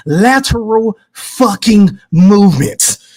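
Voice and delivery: slow, spelled-out delivery of "lateral fucking movements"; say-it-with-me emphasis. Shown as a demonstration. Leading the chat through the phrase, slow and emphatic. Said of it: "say it with me".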